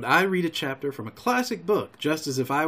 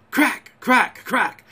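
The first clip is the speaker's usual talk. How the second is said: high, sharp, loud cracks; sudden explosive bursts; musket-shot imitation; 7 semitones higher, 4 dB louder, slower than usual. A narrator's reading. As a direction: fire each word like a gunshot. Narrator imitates three musket shots.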